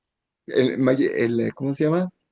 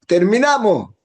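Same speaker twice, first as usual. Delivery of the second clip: higher, brighter "Terminamos" called out happily; excited announcement; 7 semitones higher than usual. Retold or imitated. Woman telling the rabbi they finished, excited. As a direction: announce "Terminamos" like proud news. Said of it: "terminó una serie de, de Mesilat Yesharim".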